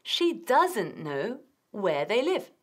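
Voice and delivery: "She doesn't know where they live" said very slowly and clearly; slow teacher demo; slow model sentence. Shown as a demonstration. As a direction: spell it out slowly for learners.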